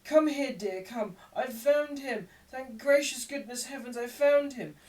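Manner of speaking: high, loud overwrought wailing; Nancy putting on a scene for onlookers; hysterical young woman. A narrator's reading. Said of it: "burst into another fit of crying".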